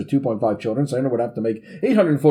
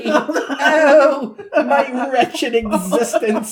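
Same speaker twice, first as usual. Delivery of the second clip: strangled squeak, much higher, laughing; high squeaky voice; 12 semitones higher, much slower than usual. Retold or imitated. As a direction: squeeze it out high and slow. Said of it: "a really high squeaky voice like this".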